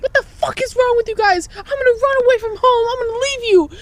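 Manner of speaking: hammed-up furious mom shriek. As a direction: shriek it like a mother at the end of her rope. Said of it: "She was like".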